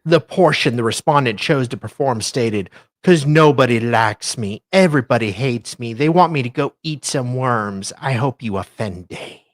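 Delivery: ordinary delivery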